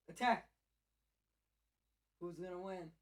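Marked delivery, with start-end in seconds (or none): 0.1-0.5 loud higher shout; cheering. Real, not acted. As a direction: egg on the fight. Cheering cats fighting.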